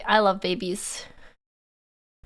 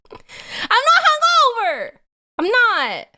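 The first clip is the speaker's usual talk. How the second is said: high and loud indignant denial; denying a hangover to chat, high mock outrage; mock protest; 11 semitones higher, 5 dB louder, slower than usual. Real, not acted. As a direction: insist playfully, drawn out and high. Denying being hungover.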